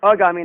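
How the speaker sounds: अगामी begun with a short "a"; wrong short vowel. Shown as a demonstration. Teacher rejecting the mistake, अगामी with short first a. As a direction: say अगामी with "uh" at the start. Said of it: "नहीं होता है".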